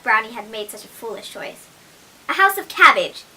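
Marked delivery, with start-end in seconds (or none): 2.3-3.2 greedy piglet, higher and louder; mouthful piglet. A narrator's reading. Greedy piglet to her mother, mouth full. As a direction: piglet talking through her food. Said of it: "answered Whitney with a mouthful".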